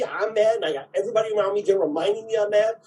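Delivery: ordinary talk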